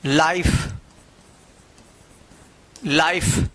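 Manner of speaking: लाइफ with ai and a silent final e; say life. Shown as a demonstration. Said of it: "तो इसको क्या पढ़ेंगे?".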